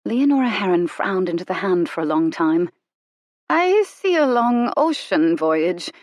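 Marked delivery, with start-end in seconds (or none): 3.5-5.9 slow, drawn-out wonder; surprised. A narrator's reading. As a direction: read it as a discovery. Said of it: "She sounded surprised".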